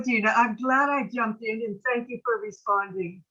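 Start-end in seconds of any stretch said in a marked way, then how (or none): none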